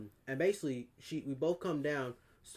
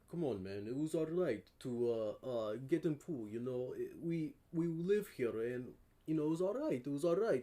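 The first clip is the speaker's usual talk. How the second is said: imitated Russian guy. Russian guy talks his way into the pool, imitated Russian accent. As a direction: coax in a put-on Russian accent. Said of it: "the Russian guy was like".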